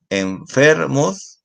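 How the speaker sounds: enfermos high and very slow, in careful syllables. Shown as a demonstration. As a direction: stretch enfermos out slowly for a class.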